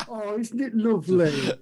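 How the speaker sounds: mock sweet praise. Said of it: "go to your father and say".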